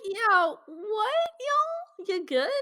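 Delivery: high-pitched, incredulous check-in; mock bewildered questioning. Mock questioning the reckless characters.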